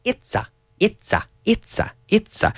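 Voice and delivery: mock stumbling, repeating it's a; hesitant stammer. Shown as a demonstration. Teacher acting out a learner getting stuck, hesitant stammer. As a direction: fake a speaker stuck on the words. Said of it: "Voy a decir".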